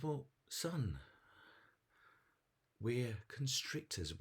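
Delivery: high, slowing snake-parent answer; big snake. Acted. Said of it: "the big snake says".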